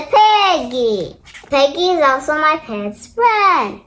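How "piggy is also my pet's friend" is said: ordinary delivery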